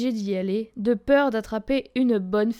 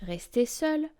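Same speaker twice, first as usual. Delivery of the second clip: usual speaking voice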